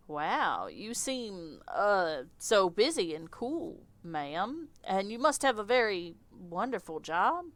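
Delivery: slow, fawning Southern flattery; lightly Southern, awkward charm; Southern accent. Acted. Victor hitting on the insect lady, light Southern accent. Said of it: "I don't know why he's Southern".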